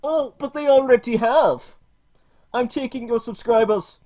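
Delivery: high, loud mocking put-on woman's voice; hammed-up parody of a preachy feminist YouTuber; parody Lacey Green. Streamer mocks Lacey Green to chat, high mocking voice. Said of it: "For I am Lacey".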